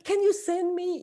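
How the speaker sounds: high-pitched impression of a friend asking; imitated friend requesting.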